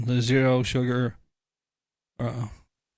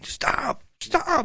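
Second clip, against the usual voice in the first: hushed imitation of a distant yell; drawn-out yell held down in volume; mock yelling. Imitating staff yelling at the president from backstage, mock yell. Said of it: "will be in back yelling".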